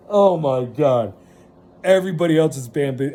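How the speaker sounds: normal talking voice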